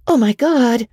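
high, sudden gasp of dismay; startled exclaim. A narrator's reading. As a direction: blurt it in shock. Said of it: "exclaimed the one called Ellie".

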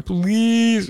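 high, drawn-out imitation whine; mock crying plea. Host imitates a friend begging to borrow the car, mock crying. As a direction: beg in a put-on tearful voice. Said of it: "and he's crying".

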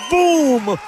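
big high-pitched imitated shout; loud, exultant one-word yell. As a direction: boom it out like the shooter. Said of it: "yells out".

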